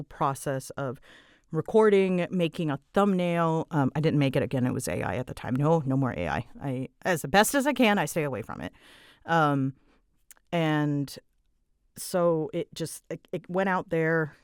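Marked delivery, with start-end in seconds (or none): none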